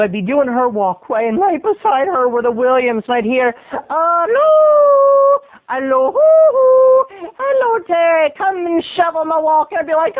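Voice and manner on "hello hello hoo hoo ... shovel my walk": very high, loud Irish hoot; Irish neighbor. Acted. Irish neighbor calls boy to shovel, Irish trill. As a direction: trill a long Irish hello across the yard. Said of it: "That's her Irish".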